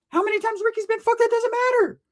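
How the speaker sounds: whiny cousin voice; high-pitched mock whine; high squeaky voice. Mocking high voice.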